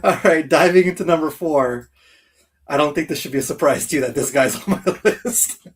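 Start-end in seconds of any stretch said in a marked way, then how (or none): none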